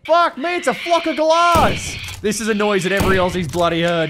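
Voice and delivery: high, loud yell of alarm, then easing; startled shouting. Real, not acted. Player startled by a screeching flock, shouting. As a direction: cry out as if ambushed by birds.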